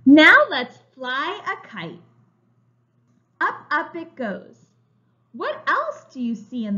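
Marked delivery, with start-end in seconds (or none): 0.1-4.6 slow, bright storybook reading; reading to children. A narrator's reading. Teacher reading a picture book to young children, slow and bright. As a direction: read it like a picture book for little kids.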